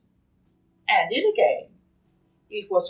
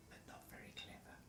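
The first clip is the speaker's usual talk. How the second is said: whisper; 34 dB quieter, much faster than usual. A narrator's reading. Narrator whispers aside.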